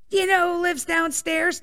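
a hint of an angry father; mock furious dad. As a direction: retell dad's fury in a high, incredulous voice. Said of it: "he's fucking furious".

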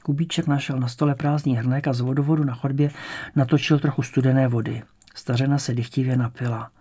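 usual speaking voice

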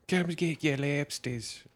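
hammed-up Boston lobster seller hawking his catch; mock Boston vendor. Friend jokes about lobster shack, in a hammed-up Boston hawker voice.

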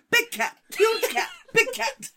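high, laughing re-enacted yell; retold yelling. Woman retells spotting a cougar, mock excited yelling. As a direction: call it out in high, excited bursts. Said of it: "I just kept yelling".

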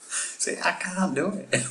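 high, accidentally Irish lilt; slipping into an Irish-like accent; Irish-sounding accent. Acted. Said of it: "like, with an Irish".

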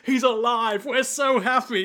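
high, gushing mock joy; overjoyed. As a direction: cheer with exaggerated relief. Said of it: "and is like".

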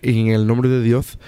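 low, mock Castilian Spanish lisp; lisping Spain accent. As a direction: imitate a priest from Spain, lisping. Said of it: "he spoke like that".

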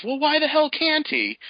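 high, exasperated mock disbelief; incredulous quote. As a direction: toss it out like an outraged reader. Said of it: "He says".

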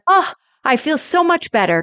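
high-pitched acted relief; delighted relief. Retelling Rose's reaction to the phone call, high relieved gushing. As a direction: imitate someone suddenly cheered up. Acting Rose cheered up by call.

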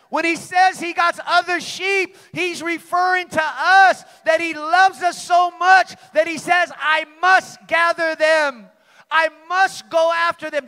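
loud, high shouted sermon climax; full-force preaching. Acted. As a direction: preach it out at the top of your voice.

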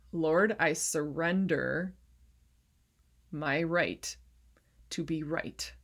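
slow, deliberate prayer with long pauses; prayer of surrender. Acted. Believer surrendering the need to be right, slow prayer. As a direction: pray it phrase by phrase, letting each settle.

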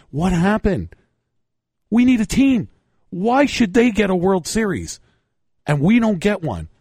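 rally the crowd; rallying fan. Retold or imitated. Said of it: "get people interested and say".

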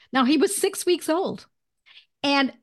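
usual speaking voice

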